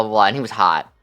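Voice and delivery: ordinary delivery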